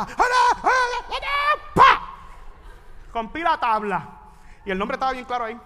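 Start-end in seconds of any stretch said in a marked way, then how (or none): 0.0-2.0 high hammed-up yells at the board; high playful mock yells; mock yelling. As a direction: yell high as if breaking a board. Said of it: "le grité a la tabla".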